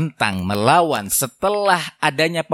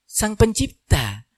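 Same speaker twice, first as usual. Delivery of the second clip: ordinary talk, nothing marked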